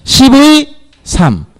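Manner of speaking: teacher modelling "십의 삼" with a drawn-out 의; model reading. Shown as a demonstration. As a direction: stretch "십의" high and loud, then "삼". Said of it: "뭐라고".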